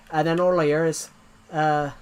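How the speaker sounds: normal talking voice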